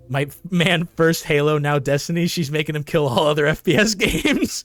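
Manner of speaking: loud laughing rant; excited joking. Real, not acted. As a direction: riff on it, amused and loud. Excited commentary joke.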